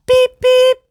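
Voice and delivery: very high, loud tooting whistle; train whistle. Acted. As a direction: toot like a brave little engine. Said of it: "Thomas whistled bravely".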